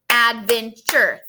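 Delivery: "adventure" said slowly in three beats, ad-ven-ture; clapped syllables. Shown as a demonstration. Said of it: "three syllables".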